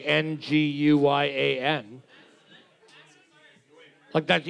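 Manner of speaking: ordinary talk, nothing marked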